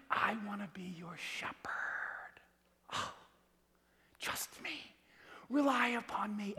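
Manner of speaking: whispered, slow and soft; tender whisper. Retold or imitated. God whispering tenderly to his children. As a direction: breathe it like a gentle plea.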